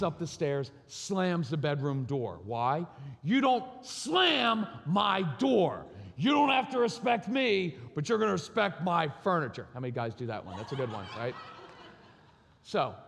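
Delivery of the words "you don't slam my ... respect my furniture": higher, louder, very slow lecture; stern scolding. Retold or imitated. Father scolding his son for slamming the door, stern and loud. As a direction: lay down the law, word by word.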